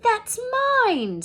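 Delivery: high, loud protest; protesting Harry. Acted. Boy claiming his letter back, loud.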